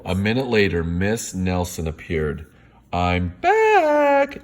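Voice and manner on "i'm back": high sing-song. A narrator's reading. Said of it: "she said".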